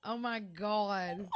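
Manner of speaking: slow, drawn-out words through real laughter; can't stop laughing. Real, not acted. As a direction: stretch it out, helpless with laughter. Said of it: "I can't stop laughing".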